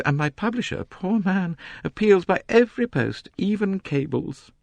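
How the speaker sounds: high, complaining society novelist. A narrator's reading. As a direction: complain grandly about the crowd. Mrs Otterbourne boasts.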